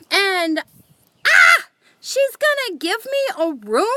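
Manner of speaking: high, loud, giddy disbelief; excited disbelief. Retold or imitated. Narrator reliving the offer of a free room, high excited disbelief. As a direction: let the excitement burst out in high questions. Retelling the stunning library offer.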